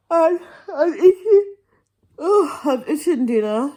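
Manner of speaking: high, loud fake bawl; hammed-up tearful moan; mock crying. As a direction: wail it in pretend misery. Mock crying over eating dinner late.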